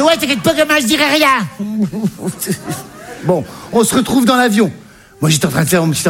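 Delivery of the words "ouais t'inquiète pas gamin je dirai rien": put-on high, cheeky old mum voice; mock mum, high and cheeky; mother's voice. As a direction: play the brassy mother, pitched up. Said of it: "Elle fait".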